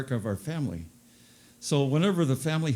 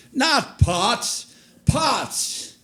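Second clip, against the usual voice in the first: much higher, louder Polish grandpa insisting "pots"; frustrated old Polish man correcting "pots"; high excited Polish accent; 10 semitones higher, 4 dB louder, much slower than usual. Retold or imitated. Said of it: "He always got excited".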